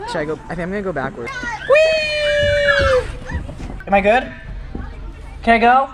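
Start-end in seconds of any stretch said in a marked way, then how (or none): 1.7-3.4 gleeful whee. Real, not acted. Sliding down backwards, a high gleeful squeal. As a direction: let it fly down the slide. Going down the slide.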